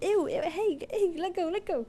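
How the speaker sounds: high, squeaky put-on cat voice; voicing the cat. Owner voicing her cat pulling its paw away, high squeak. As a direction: squeak it like a cat being held. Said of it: "he's like".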